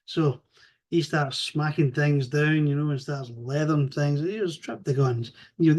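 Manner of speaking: ordinary talk, nothing marked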